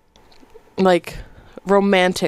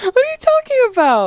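much higher, disbelieving protest; incredulous; 13 semitones higher than usual. Real, not acted. Friend protests the host's teasing, high and incredulous. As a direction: push back in high disbelief.

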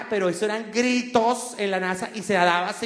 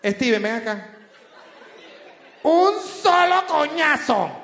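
stern mother; 6 semitones higher, 5 dB louder, slower than usual. Retold or imitated. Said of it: "le hubiera dicho".

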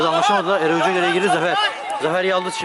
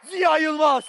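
screaming football commentator; 11 semitones higher than usual. Real, not acted. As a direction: shout the scorer's name in joy. Goal celebration commentary.

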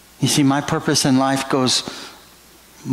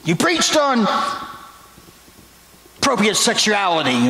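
put-on much higher, strained voice for the young man; young man's complaint; 8 semitones higher than usual. Young man tells the preacher what offended him, pitched high. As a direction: squeak it out like an embarrassed young man. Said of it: "He said".

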